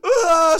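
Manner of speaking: loud high mock wail; imitated scream crying. Comic imitates girlfriend starting to bawl, wailing. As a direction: burst into an exaggerated crying scream. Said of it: "scream crying".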